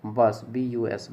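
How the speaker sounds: teacher spelling the word बस as B U S. Shown as a demonstration. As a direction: spell बस letter by letter as B U S, slowly. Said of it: "किसमें?".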